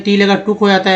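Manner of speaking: ordinary talk, nothing marked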